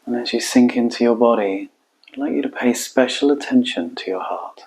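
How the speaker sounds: calm meditation guide, long unhurried pauses; guided meditation. Acted. Coach guiding listeners into their hearts, calm guided meditation. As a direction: guide them inward, leaving space between phrases.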